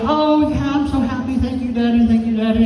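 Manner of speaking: put-on high child voice, gushing thanks; grateful little girl. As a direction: imitate a happy kid thanking her dad. Acts grateful happy daughter.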